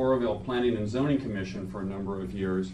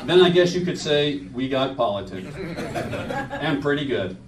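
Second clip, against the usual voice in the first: laughing; 4 semitones higher, 6 dB louder, slower than usual. Real, not acted. Joking aside with laughter.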